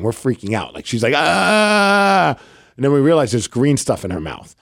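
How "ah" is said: imitated child's cry. Dad retelling his daughter's restaurant scare, mock crying wail. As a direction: mimic a small child wailing. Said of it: "just starts crying".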